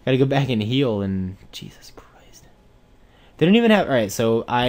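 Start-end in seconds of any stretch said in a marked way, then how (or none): 1.5-2.5 exasperated whisper; hushed disbelieving whisper. Real, not acted. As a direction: breathe it out in disbelief. Exasperated at being beaten.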